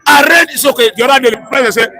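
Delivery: ordinary talk, nothing marked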